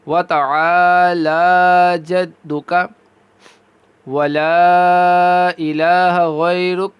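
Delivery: measured tajweed chant; Quranic recitation. Shown as a demonstration. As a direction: chant the prayer slowly, phrase by phrase. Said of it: "ایک دفعہ پھر پڑھ لیتے ہیں".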